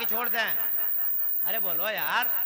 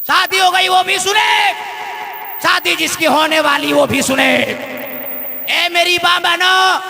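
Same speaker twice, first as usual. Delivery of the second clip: shouted, higher-pitched call to the congregation; full-force preaching; 7 semitones higher, 11 dB louder than usual. Acted. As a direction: summon the whole crowd to listen. Calls to the women.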